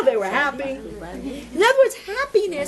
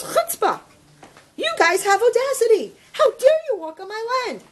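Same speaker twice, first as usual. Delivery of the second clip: raised, higher indignant scolding; acted-out indignation, pitched up; outraged landowner; 8 semitones higher than usual. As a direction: scold trespassers with mock outrage. Outraged landowner confronts trespassers in story.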